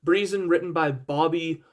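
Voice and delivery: ordinary delivery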